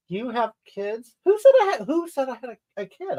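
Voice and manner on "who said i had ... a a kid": high-pitched, affronted disbelief; indignant protest. Real, not acted. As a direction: squawk back at the very suggestion, pitched way up. Protests viewer asking about kids.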